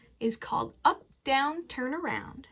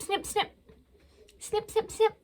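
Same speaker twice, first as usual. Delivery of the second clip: high crisp snips; snipping sound; 9 semitones higher than usual. Pretend. Librarian imitating crab pincers, crisp and high. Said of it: "It goes snip".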